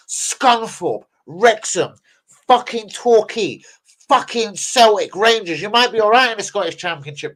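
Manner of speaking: high-pitched furious tirade; rising heated rant; angry rant. Real, not acted. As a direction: tell him to get out of the club, fired up.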